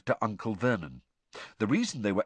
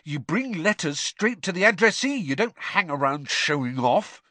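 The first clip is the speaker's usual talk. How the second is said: higher-pitched cross telling-off; scolding; 7 semitones higher than usual. A narrator's reading. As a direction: tell off a naughty pet. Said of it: "Ron hissed".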